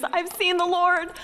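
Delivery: high, ringing excited news; elated announcement. Acted. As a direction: proclaim it high and overjoyed. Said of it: "went to the disciples with the news".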